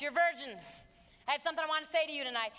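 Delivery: normal talking voice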